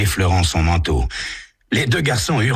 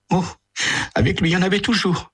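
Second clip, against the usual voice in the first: high, fast, exasperated; mother complaining about son to detective, fast, higher; weary mother; much faster than usual. Acted. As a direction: sigh about a troublesome son. Mother voiced.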